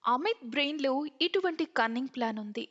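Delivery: usual speaking voice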